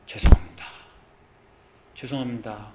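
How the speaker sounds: hushed, fading repeated sorry; whispered apology. Acted. Penitent woman whispering to Jesus, hushed and fading.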